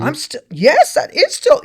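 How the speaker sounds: high, loud acted outburst; startled recognition. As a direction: burst out as it dawns on you. Acts realization while writing.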